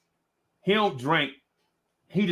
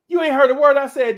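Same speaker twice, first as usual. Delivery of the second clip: mock scolding; 11 semitones higher, 9 dB louder than usual. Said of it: "she's like".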